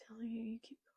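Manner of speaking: barely voiced whisper under the breath. Real, not acted. Reader whispering to the page, willing it forward. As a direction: whisper it to yourself, urging the story on. Muttering at the manga.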